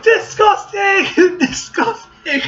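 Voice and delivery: high, slow furious shrieks; shaking with anger. Acted. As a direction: shriek it out in outrage. Outrage at dairy, escalating shouts.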